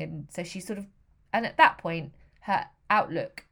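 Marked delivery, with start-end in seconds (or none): none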